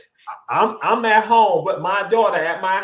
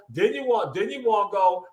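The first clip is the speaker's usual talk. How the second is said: normal talking voice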